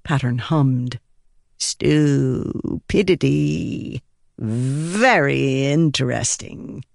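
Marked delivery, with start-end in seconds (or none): 1.6-6.8 slow, curious odd voice; musing spren. Acted. Pattern musing on human stupidity, slow. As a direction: savor the word like an alien.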